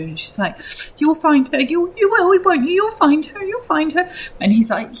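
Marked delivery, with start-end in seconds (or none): 1.0-4.1 high, pleading mother's voice; distraught mother. Acted. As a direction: beg for reassurance in a high voice.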